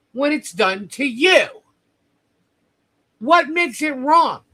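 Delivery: angry. Real, not acted.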